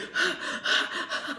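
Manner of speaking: high, unvoiced winded exhale; exhausted breath. Skier too winded to answer the patrol, exhausted exhale. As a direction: puff out air, too tired to speak. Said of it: "I'm just breathing".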